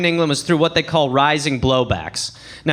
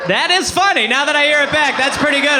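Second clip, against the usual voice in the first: laughing; 7 semitones higher than usual. Retold or imitated. Laughing realization, loud.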